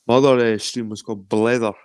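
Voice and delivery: ordinary talk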